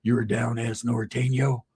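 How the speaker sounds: low, slow impression of the huge boss; big man's voice. Huge boss questioning the newcomer, low and slow. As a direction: size him up low and slow. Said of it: "that's how he talked".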